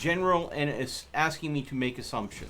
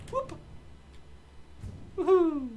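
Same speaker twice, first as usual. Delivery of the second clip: excited whoop. Real, not acted. Cheering while riding.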